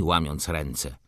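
usual speaking voice

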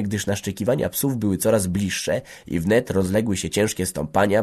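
usual speaking voice

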